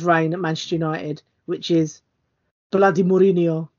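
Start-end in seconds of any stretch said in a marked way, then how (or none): 2.7-3.7 mock thick Cypriot accent, like his dad. Son quoting his Man United dad, in a mock Cypriot accent. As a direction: imitate the dad's Cypriot accent grumbling the name. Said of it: "his thick Cypriot accent".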